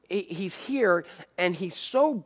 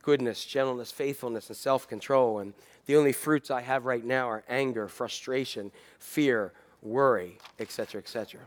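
worn-out pastor; 6 semitones lower, 5 dB quieter than usual. Retold or imitated. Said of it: "pastors who say".

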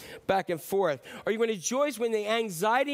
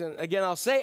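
ordinary talk, nothing marked

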